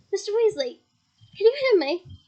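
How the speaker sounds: calling through. Acted. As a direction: raise the voice to carry.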